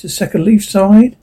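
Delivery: ordinary delivery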